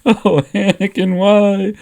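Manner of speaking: Obi-Wan sobbing at a photo of Anakin, melodramatic wail; hammed-up bawling. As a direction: howl over the lost friend, overdone. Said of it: "just bawling his eyes out".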